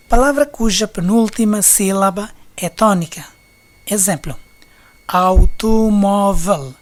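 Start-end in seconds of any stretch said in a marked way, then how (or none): 5.1-6.7 automóvel slow and loud, au-to-mó-vel; syllable by syllable. Shown as a demonstration. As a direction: give every syllable of automóvel its own beat. Exemplo de divisão em sílabas. (automóvel, sílaba por sílaba, devagar)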